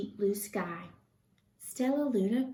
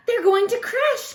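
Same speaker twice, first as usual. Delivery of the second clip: much higher, louder frightened cry; alarmed gasp; 13 semitones higher, 10 dB louder than usual. A narrator's reading. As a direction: gasp out the danger. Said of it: "gasped Stellaluna".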